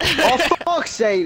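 high, loud, slow exasperated groan; frustrated outburst. Real, not acted. Gamer losing his target, loud exasperated outburst. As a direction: let the annoyance burst out.

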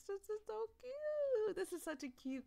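swooning over cuteness; squealing gush. Acted. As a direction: gush like it's too adorable. Gushing over a cute moment.

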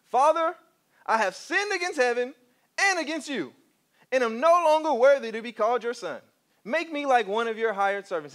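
high, loud mock-grand confession; overhyped rehearsed speech. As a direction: proclaim it too hyped, rehearsing a big apology. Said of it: "That was a little too hype".

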